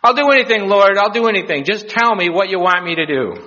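hammed-up desperation, easing slightly; eager desperate pleading. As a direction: beg to be told what to do. Cliff hanger begs for instructions.

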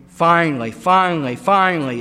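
each finally punched loud and falling; forceful preaching. Acted. Preacher announcing the plan has come, loud and punched. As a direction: drive the word three times.

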